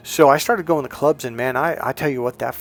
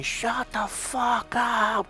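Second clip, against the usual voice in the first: high, drawn-out mock scream; imitated scream; 10 semitones higher, 4 dB quieter, much slower than usual. Coach retelling how he screamed at his student, mock scream. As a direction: stretch each word long and high, without real volume. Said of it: "Mike literally screamed at me".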